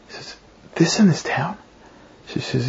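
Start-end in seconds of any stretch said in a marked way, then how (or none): 0.7-1.6 half-whispered, stunned repeat; hushed echo question. Retold or imitated. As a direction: echo it softly as a disbelieving question. Man echoes the name in disbelief.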